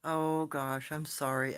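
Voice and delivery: ordinary talk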